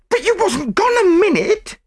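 loud, very high indignant voice; angry retort. A narrator's reading. Chambermaid retorts to the French maid, angry. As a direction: fire back, pitched right up. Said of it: "retorted the chambermaid angrily".

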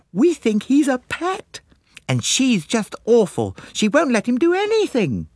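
very high, gushing schoolgirl voice; exclaiming girls. A narrator's reading. Two girls confiding about colonel's wife, high exclaiming. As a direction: exclaim it in high girlish excitement. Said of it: "the two girls exclaimed".

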